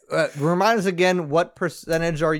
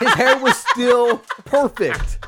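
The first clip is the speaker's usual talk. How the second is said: laughing; 7 semitones higher, 4 dB louder, slower than usual. Real, not acted. Laughing at friend.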